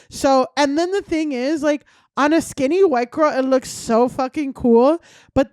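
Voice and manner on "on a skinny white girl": usual speaking voice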